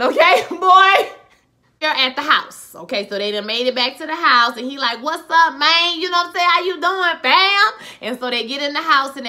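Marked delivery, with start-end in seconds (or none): 0.0-1.1 amused mock scolding; laughing exclamation. Real, not acted. Mock scolding over the gun. 5.0-7.7 loud, high-pitched acted hello; boisterous retold welcome; hearty greeting.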